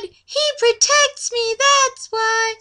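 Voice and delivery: put-on high, slow child voice; little girl voice. Laura explaining why Legolas keeps her, high and slow. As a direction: say it sweetly way up high.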